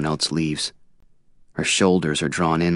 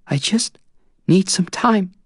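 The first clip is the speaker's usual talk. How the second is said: much higher voice, strained, near tears; choking on words; 14 semitones higher, 4 dB louder, slower than usual. A narrator's reading. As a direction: force the words through tears. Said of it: "choking on each word".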